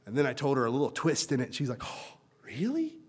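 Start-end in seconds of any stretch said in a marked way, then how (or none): 1.8-3.0 higher, slow, soft amazed question; a child's hushed amazement; surprised. Retold or imitated. Girl surprised by twist.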